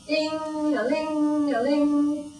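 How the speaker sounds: slow, high sing-song ringing; phone ringing imitation. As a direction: ring it out like a bell.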